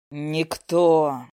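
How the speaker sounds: low forceful dragon outburst; dragon roar. A narrator's reading. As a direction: bellow it as the beast. Dragon roars in reply.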